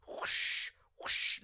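hushed, voiceless rushing-wind noise; mouth-made gust of storm static; imitated storm whoosh. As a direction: blow a whoosh into the mic.

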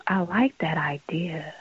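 slow breathy whisper; hushed slow aside. Real, not acted. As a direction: whisper it conspiratorially. Reacting to idea, whispering.

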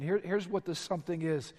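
ordinary talk, nothing marked

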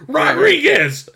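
high, slow drawn-out shout; imitated game character's shout; yelled battle cry. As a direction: stretch the name into a loud yell. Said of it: "he just fucking yells it".

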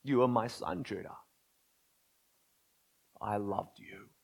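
hushed, heartbroken father's voice; a father's quiet lament to his son; grieving father. Acted. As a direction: speak it softly, like a wounded parent. God voiced speaking to Judah.

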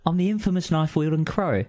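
gruff Cockney bird, teasing; Cockney accent. Acted. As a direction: crow speaking in a Cockney accent. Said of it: "my Cockney accent".

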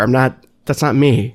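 usual speaking voice

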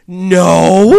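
loud whining denial; whiny no. Retold or imitated. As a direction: wail it like a whine.

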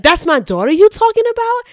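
loud, high mock outrage; Stanley. Fan acting the father defending his daughter, imitated outrage. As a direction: imitate an indignant father confronting someone.

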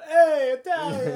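loud, high, slow hammed-up Italian call; a jokey over-the-top Italian hail; mock Italian greeting. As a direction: shout it like a cartoon Italian. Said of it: "I'm like".

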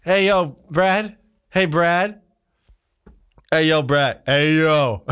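calling for someone outside the room. Real, not acted. Host to co-host behind the door, calling out. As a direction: call him back in with short hails.

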